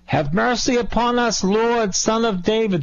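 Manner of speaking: high, hollered cry to get attention; yelled plea. Acted. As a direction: yell it up high to be heard. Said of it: "They yelled".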